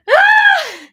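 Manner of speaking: very high, loud scream; frustrated scream. Real, not acted. Host hearing fake friendship, exasperated scream.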